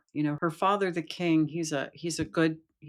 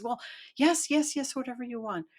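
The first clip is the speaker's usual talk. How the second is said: high-pitched, brushing-off princess voice; hasty princess agreement; 10 semitones higher than usual. Acted. Princess promises the frog whatever he wants, high and hasty. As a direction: agree to anything, high and eager.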